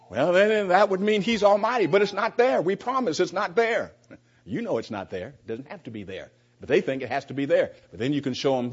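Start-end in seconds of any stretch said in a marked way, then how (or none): 0.1-3.9 mock insistent denial. Role-played Witness insisting.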